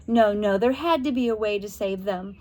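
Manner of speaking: usual speaking voice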